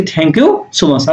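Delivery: slow, careful thanks; slow model phrase. Shown as a demonstration. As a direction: say it slowly for learners. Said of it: "আমরা এটা বলবো কি". (ধীরে ধীরে বলা)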